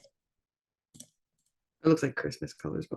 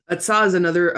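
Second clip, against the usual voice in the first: ordinary delivery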